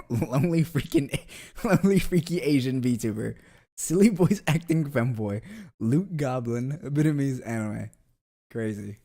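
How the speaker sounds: laughing while reading. Real, not acted. Streamer reads funny stream tags aloud, laughing. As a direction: read the tags out and crack up.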